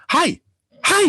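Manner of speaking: high-pitched little hi; imitated toddler greeting. Toddler greeting strangers in a store, eager. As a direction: chirp it like a small child.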